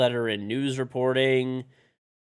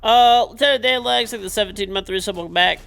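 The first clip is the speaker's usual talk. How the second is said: excited shoutout; 10 semitones higher, 11 dB louder than usual. Real, not acted. Thanking a resub.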